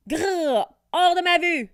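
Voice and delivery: high, loud furious outburst; angry growl. Acted. Diego blasting the wizard away, angry shout. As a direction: growl and drive him off.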